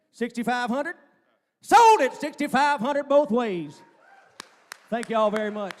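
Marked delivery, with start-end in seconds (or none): 1.6-3.8 sold call. Acted. Auctioneer closing the sale.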